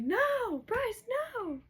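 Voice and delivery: high-pitched imitated scream of protest. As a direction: cry out in horror, voice leaping way up. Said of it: "Everybody's screaming at her".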